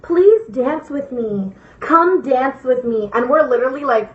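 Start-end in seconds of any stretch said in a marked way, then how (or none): 0.0-3.1 very slow, drawn-out plea; imitated weird girl. Weird girl pleads with strangers to dance, slow put-on voice. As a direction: stretch the invitation out, imitating her oddness. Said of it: "she's being like".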